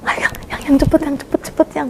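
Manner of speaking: high, hushed retold urging; urgent hurrying. Telling partner to flee papa, hushed urgent hurrying. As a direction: urge someone to hurry under your breath.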